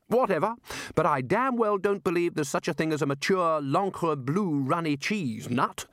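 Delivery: put-on a bluff old wizard thinking aloud; hearty Arch-Chancellor. A narrator's reading. Ridcully muses to the wizards, lightly blustering.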